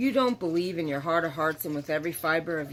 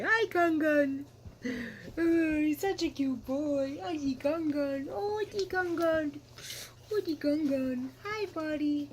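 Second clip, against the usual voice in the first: sweet talk to Gunner, pitched way up; cooing to the dog; 11 semitones higher, slower than usual. Real, not acted. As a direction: coo up high at the puppy, drawn out. Cooing to her wagging dog.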